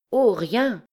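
high, embarrassed little denial; flustered. A narrator's reading. Young woman answering the stranger, flustered. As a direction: brush it off in confusion. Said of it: "dit Liénarde toute confuse".

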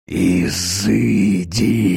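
very slow, drawn-out exorcist's cry; terrible stretched-out command; frightful alien voice. A narrator's reading. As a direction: bellow it like someone possessed. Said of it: "закричал Капустин страшным, чужим голосом".

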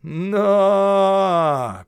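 high, loud gruff shouting. A narrator's reading. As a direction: yell at the horse from below. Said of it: "кричит Губин".

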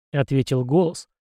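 ordinary delivery, nothing marked

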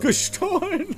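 laughing the word out high and mocking; bitter disbelieving laugh; scornful laugh. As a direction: scoff through a laugh. [name] scoffs at the excuse.

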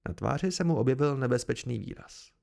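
ordinary delivery

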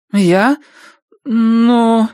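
high, loud, hesitating; hesitant. Acted. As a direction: stall before answering. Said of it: "Сара поколебалась". (нерешительно)

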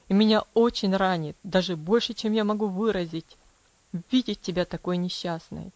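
low, gentle father's voice; tender father. Acted. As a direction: reach out to her lovingly. Father tenderly pleads with daughter. (нежно, низким голосом отца)

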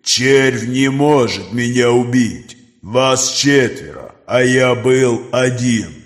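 slow, distant predatory creature; monster voice. Acted. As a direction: speak like a cold ancient beast. Creature Nokris speaks.

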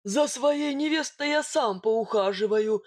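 sullen, territorial bogatyr voice; bullish. A narrator's reading. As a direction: warn off a rival, bull-headed. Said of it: "набычился богатырь".

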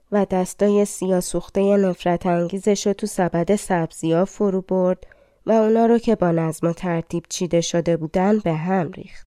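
ordinary delivery

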